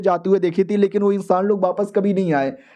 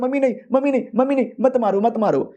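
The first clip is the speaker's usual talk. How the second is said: mock panicked cry; 5 semitones higher, faster than usual. Dazed man begging rescuers not to hit him, mock panicked cry. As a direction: imitate a man crying out in fright. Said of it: "होश में आते ही चिल्लाने लगता है".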